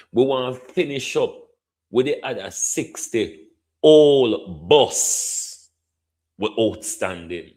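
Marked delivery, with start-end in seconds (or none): 1.9-5.7 put-on slow, louder, hitting "old" in "sixty old bus"; stress on old. Shown as a demonstration. MP pressing the minister, slow and loud on "old". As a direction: drag it out slowly and land hard on "old".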